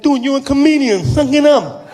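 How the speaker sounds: high, loud voice of a deaf man; insistent deaf fan. Acted. Deaf fan tells comedian to toughen up, high loud insistence. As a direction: press the point loudly in deaf speech. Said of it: "he was partially deaf".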